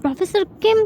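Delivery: huffy threat. Acted. Jin threatening Jun.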